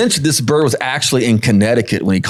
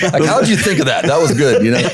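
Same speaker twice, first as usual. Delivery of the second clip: asking through laughter, higher; laughing; 3 semitones higher than usual. Real, not acted. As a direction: laugh while you ask. Laughing at the hat trick.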